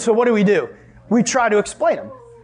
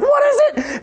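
very high-pitched panicked question; freaking out. Acted. Family watching the trash bag being pulled out, freaking out. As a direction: voice shooting up in mock terror. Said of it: "freaking out".

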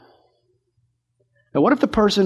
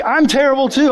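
ordinary talk

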